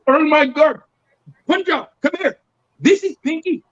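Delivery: imitated Indian accent. Fan calls Punjab over, imitated Indian accent. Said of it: "And this Indian guy".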